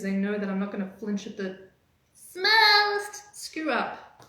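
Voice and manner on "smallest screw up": very high, very slow squeaky voice; high-pitched squeak. Real, not acted. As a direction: let the voice shoot up into a stretched squeak. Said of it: "I love how high-pitched my voice gets".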